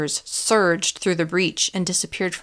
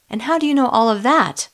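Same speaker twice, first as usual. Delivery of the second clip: loud, higher demanding question; indignant demand; angry challenge; 5 semitones higher, 6 dB louder than usual. Acted. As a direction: snap it back at him. Challenging Billy angrily.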